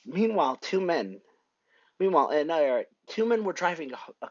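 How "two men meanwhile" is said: ordinary delivery, nothing marked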